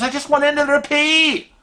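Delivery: high-pitched mock whine; plaintive protest. Husband protesting to wife about restroom encounter, high plaintive whine. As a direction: wail it like an imitated hapless victim.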